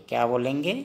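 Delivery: usual speaking voice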